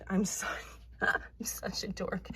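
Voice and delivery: breaking into a whispery laugh; embarrassed laughing. Real, not acted. Apologizing to viewers for being dorky, laughing. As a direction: laugh at yourself, voice dropping to a whisper. Laughs at herself over the book.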